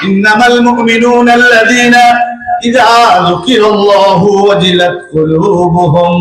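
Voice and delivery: slow, chanted Arabic verse; Quran recitation. Acted. Preacher closing with a recited verse, chanted. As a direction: intone it melodically, drawing out words.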